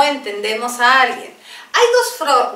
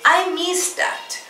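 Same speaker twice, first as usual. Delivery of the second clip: very slow model phrase; 6 semitones higher, much slower than usual. Shown as a demonstration. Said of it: "También podemos decir".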